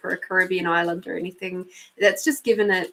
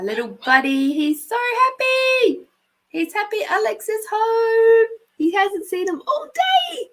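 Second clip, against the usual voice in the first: gushing about the dog in a much higher voice; cooing pet talk; 14 semitones higher, 4 dB louder than usual. Real, not acted. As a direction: coo over the happy puppy like a doting owner. Cooing about the excited chihuahua.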